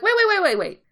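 high quick repeated wait; family member cutting in, startled; alarmed protest. Retold or imitated. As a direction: stop her mid-clean-up.

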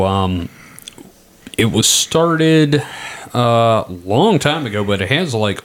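ordinary delivery

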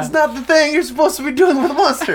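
high, loud outraged yell; shocked yelling. Retold or imitated. Retold shock at being kissed.

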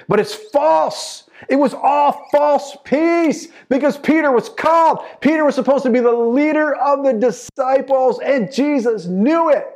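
loud, high pulpit cry; fervent preacher in full flight; full-force preaching. Real, not acted. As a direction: drive the point home to the congregation.

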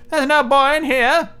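wife covering for Jack to her husband; giant's wife. Acted. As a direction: play the fibbing wife sweetly. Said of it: "The wife said".

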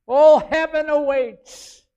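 slow, loud proclamation; preaching climax. Real, not acted. Preacher proclaims heaven to the congregation, slow and loud. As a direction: proclaim it to the back row.

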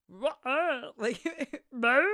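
high, strange cracked yelp; imitated voice crack. Trying to copy his weird crack, cracked squawk. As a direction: crack the voice on a nonsense noise, half laughing. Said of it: "I wish I could remember exactly how he did it".

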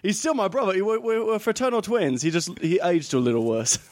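mock British twin brother; Tom Hardy in Legend. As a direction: keep up a London hard man bit. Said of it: "he's going for Tom Hardy in Legend".

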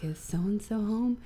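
hushed unvoiced question at the door; whisper. Stranger at the door asking for her, slow whisper.

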